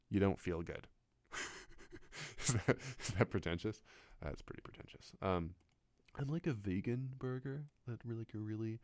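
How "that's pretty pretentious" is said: unvoiced whispered aside to himself. Real, not acted. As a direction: whisper it under your breath. Self-mocking aside.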